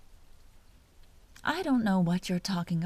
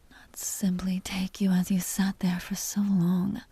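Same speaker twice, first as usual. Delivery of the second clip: a vampire's hushed, teasing whisper close to the ear; seductive whisper. Acted. Vampire whispering to the hunter she wants to bite, seductive whisper.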